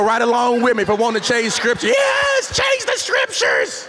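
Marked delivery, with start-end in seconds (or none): 1.9-3.9 mock cheer.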